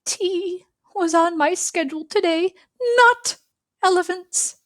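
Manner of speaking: panicked whisper. Acted.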